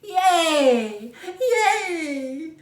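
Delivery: high, loud, laughing yay; joyful cheer. Acted. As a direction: cheer with delight. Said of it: "began to smile and cheer".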